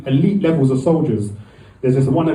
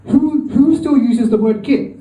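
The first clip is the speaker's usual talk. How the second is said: incredulous; 7 semitones higher, slower than usual. Retold or imitated. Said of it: "I was like".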